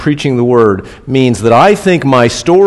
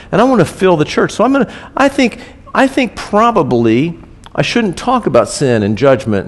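higher, quick, mocking tone; high, quick sarcasm; sarcastic mock reasoning; 7 semitones higher than usual. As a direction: parody the people-pleasing preacher.

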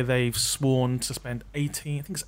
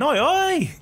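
loud, high-pitched hail; mock Cockney shout; 12 semitones higher, 4 dB louder than usual. Host joking about a walk-on part, mock shout. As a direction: call it out like a passer-by hailing someone.

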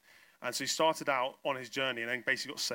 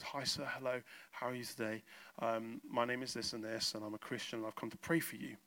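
retold respectful greeting; 3 semitones lower, 7 dB quieter than usual. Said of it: "He actually is quite honourable to him".

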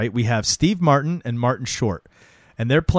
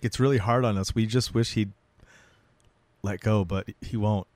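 retold sad story; 3 semitones lower, 5 dB quieter than usual. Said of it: "turns it into this fucking sad story".

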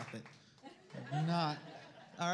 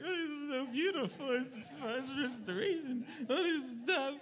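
mock gushing; 9 semitones higher than usual. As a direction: play up the tearful new parent. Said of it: "I'm like".

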